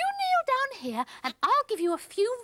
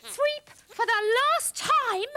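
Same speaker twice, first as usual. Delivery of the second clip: louder, slow, exasperated telling-off; exasperated scolding; 3 semitones higher, 5 dB louder, slower than usual. Acted. Scolding Sweep about the sock, slow and exasperated. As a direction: lay it down slowly, fed up.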